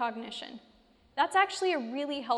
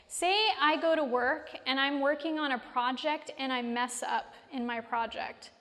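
usual speaking voice

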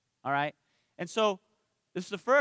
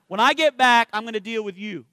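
louder, mock menacing repeat; threat built up loud; 2 semitones higher, 9 dB louder than usual. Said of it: "I gave her a look like".

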